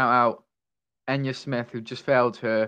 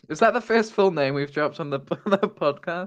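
laughing; 5 semitones higher than usual. Real, not acted. Laughing at full name slip.

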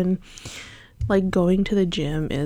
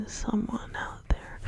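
whisper; 10 dB quieter than usual. Pretend. Trailing whispered greeting.

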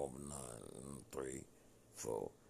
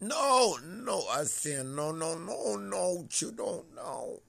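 very high, very loud refusal; shouted protest; 14 dB louder, slower than usual. Retold or imitated. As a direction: retell it as a full-force yell. Retells his own loud protest.

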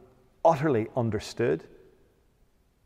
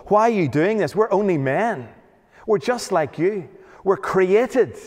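loud, raised rebuke; strongest language; 5 semitones higher, 4 dB louder than usual. Retold or imitated. Paul and Barnabas rebuking the Lystrans, loud and raised. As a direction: slam them down with a higher, forceful voice. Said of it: "slam them down with the strongest language possible".

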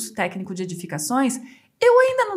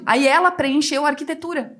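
ordinary talk

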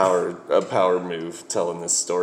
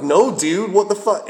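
higher, louder confused protest; exasperated; 12 semitones higher, 6 dB louder than usual. Retold or imitated. On the phone to his bass player, a higher, louder baffled protest. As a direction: demand an answer, baffled and annoyed. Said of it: "I'm like".

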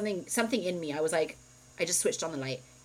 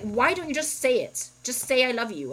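retold exasperation, higher and louder; pissed off; 7 semitones higher, 6 dB louder than usual. Retelling plea to husband to say it, exasperated. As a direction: demand it from him in frustration. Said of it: "I would get pissed".

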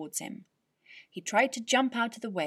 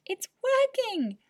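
much higher, louder and slower, thrilled; excited cry; 8 dB louder than usual. Acted. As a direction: shout it with amazed joy. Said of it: "he cried".

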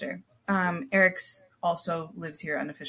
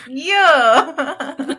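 laughing; 7 semitones higher, 10 dB louder than usual. Real, not acted. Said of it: "laugh cry".

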